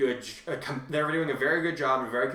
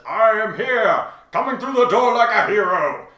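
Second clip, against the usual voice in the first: slow, booming hero proclamation, higher and louder; All Might voice; 8 semitones higher, 9 dB louder than usual. Retold or imitated. The host quotes the dubbed hero's entrance line, booming slow hero voice. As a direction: announce your entrance like a larger-than-life hero. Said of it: "and he says".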